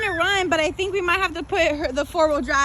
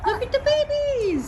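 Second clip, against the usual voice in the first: delighted showing; 9 semitones higher than usual. Real, not acted. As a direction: coo it high to a little one. Showing a child baby animals.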